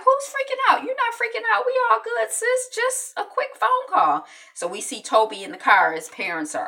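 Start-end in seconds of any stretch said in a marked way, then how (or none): 0.0-4.2 very high, squeaky reassurance; hammed-up high-pitched denial; mock reassurance. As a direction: imitate a brother insisting all is fine, way up high. Brother soothing panicking sister, acted.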